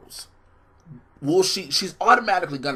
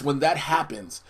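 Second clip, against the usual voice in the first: usual speaking voice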